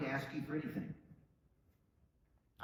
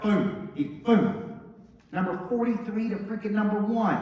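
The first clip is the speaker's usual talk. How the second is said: louder and higher burst; excited boom; 5 semitones higher, 8 dB louder, slower than usual. Acted. Speaker hypes his agent's jump to number one, excited. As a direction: explode on the booms.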